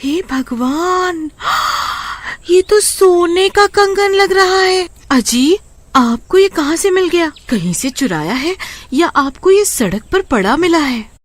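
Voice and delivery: high, astonished wife; wife stunned by the gold, voice well up; amazed wife. Acted.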